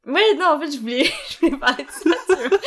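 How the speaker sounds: laughing nervously through the words. Real, not acted. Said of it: "c'est un rire nerveux".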